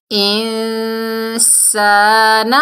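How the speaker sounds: انسان with a hidden nasal ن and a drawn-out ا; ikhfa nasal. Shown as a demonstration. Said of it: "سین کو سختی سے پڑھیں گے". (انسان میں اخفا کے ساتھ)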